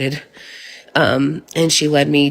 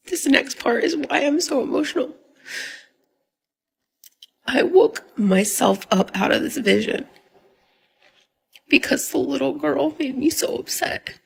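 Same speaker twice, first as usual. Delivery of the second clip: crying, voice pitched up and breaking; fighting back tears while telling it; tearful; 10 semitones higher than usual. Real, not acted. Mother recounting her son's cries, tearful and breaking.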